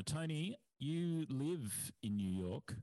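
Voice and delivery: usual speaking voice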